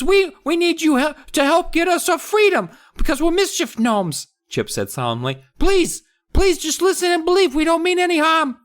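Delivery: tiny gnome proud of mischief; mischievous gnome voice. Acted. Gnomes bragging to the kids about mischief, very high.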